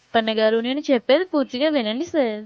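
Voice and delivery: ordinary talk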